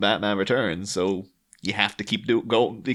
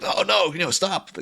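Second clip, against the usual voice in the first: high-pitched mock plea; imitated alarm; 8 semitones higher than usual. Ivy seeing Batman grab a plant, mock distress. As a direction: beg in a raised, lightly dramatic voice. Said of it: "she's very, very sensitive".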